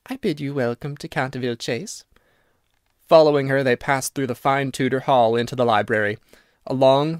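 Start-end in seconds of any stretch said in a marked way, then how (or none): 0.1-2.1 put-on soft, quaint old servant's greeting; bow and welcome them the old-fashioned way; quaint old-fashioned manner. A narrator's reading. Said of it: "in a quaint, old-fashioned manner".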